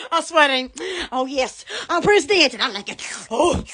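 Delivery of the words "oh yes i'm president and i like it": ordinary talk, nothing marked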